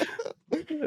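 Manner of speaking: soft high-pitched giggle; breathy high giggle after the joke. Real, not acted. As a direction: let a quiet high laugh slip out. Joking about a mishap.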